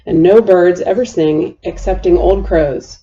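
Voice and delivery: usual speaking voice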